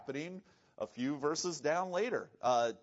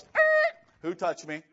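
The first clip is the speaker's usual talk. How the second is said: startled question; 10 dB louder than usual. Retold or imitated. Jesus startled in the crowd, asking who touched him. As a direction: jolt as if touched. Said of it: "Jesus goes".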